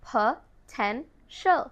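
potential stretched very slowly, syllable by syllable, with the ending "shul"; slow teacher demo; slow drawn-out model. Shown as a demonstration. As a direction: draw out Potential slowly so learners can copy it. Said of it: "The right pronunciation is".

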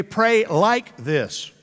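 measured lead for the crowd to echo; slowly, word by word, with space between; slow, for repeating. Shown as a demonstration. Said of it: "Repeat after me".

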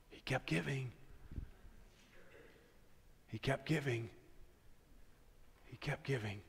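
soft, hushed repeated words; quiet repetition. Real, not acted. Preacher quietly repeating his key point, hushed. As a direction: let it sink in softly.

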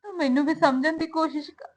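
soft, crying voice, begging to be understood; tearful plea. Acted. Daughter begging her mother to understand, crying softly. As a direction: let the tears break through the words.

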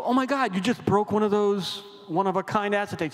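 high-pitched horrified gasp of a reply; imitating the group's shock, high up. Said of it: "we go".

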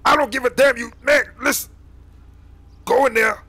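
heated, high and loud; angry order. Acted. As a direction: override the kid's objection forcefully. Leader snaps at reluctant kid.

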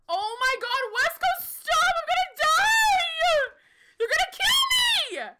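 high panicked shriek; panicked screaming. Real, not acted. Panicking in the firefight.

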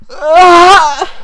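high loud cry breaking into a laugh; shrieking laughter; screaming laugh. Real, not acted. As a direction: scream then crack up.

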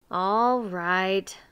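drawn-out, grudging agreement; sulky. A narrator's reading. Miser accepts the cat chores, sulky. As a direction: give in with a sulk. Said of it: "said Mister Miser sulkily".

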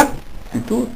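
breathy whisper, sudden drop; whispered word. Acted. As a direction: let the last word fall to a whisper. Preacher drops voice on key word.

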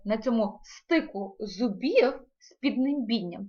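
ordinary talk

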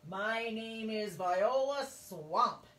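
slow, loud, scratchy menacing introduction; scratchy witchy voice. Acted. Substitute introduces herself to the class, slow, loud and scratchy. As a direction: loom over the class like a witch. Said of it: "said the lady in a scratchy voice".

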